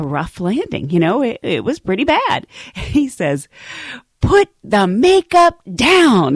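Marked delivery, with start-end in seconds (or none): 4.2-6.4 high, loud, drawn-out scolding quip; mock command. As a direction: stretch each word like an exasperated order. Retelling coworker's quip after landing.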